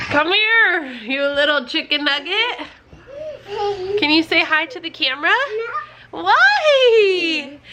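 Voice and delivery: very high, gushing mom voice. Real, not acted. Mom coaxing her little boy over to admire his haircut, cooing. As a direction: coo in drawn-out, lifted swoops.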